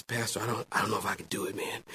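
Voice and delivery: very soft, low, nervous confession; nervous groom. Retold or imitated. Nervous groom confiding in his pastor, hushed and anxious. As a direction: confide your wedding jitters quietly. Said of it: "but I'm nervous now".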